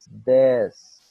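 loud, punched Desks for drilling; Desks, repeated with force; emphatic repeat. Shown as a demonstration. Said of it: "over and over again".

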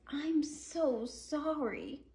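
high, slow and tender apology; sorry little dinosaur. Pretend. The little dinosaur apologising to his parents, high and tender. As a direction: say sorry sweetly like a small child. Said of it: "He says".

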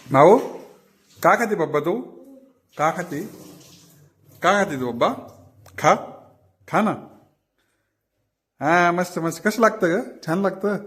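put-on high, coaxing baby talk to a cat; pet talk. Owner coaxing the cat Mau, high baby talk.

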